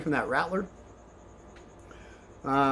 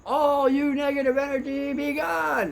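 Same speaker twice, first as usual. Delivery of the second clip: high, ringing banishing command; commanding incantation; 10 semitones higher than usual. Retold or imitated. Shaman's helper banishing the negative energy, high ringing command. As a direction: cast the negative energy out like a spell.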